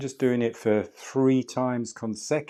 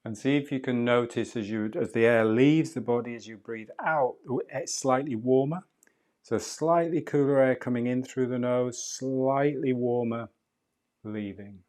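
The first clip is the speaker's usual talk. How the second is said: calm, unhurried meditation guide voice; soothing breathwork guide talking a student through the breath; guided breathing meditation; 3 dB quieter than usual. Acted. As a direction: ease the listener through each breath, gently.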